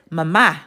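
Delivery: mama stressed on the final syllable, ma-MA; stress on second syllable. Shown as a demonstration. Teacher reads out the accented word, mama stressed ma-MA. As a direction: hit the end of mama: ma-MA. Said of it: "put the emphasis over the letter".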